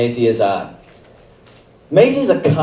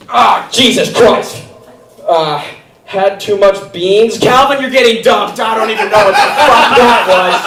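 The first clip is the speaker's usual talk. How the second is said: angry manic teacher; 8 semitones higher than usual. Pretend. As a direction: bark at the student like a furious teacher. Teacher scolding audience members.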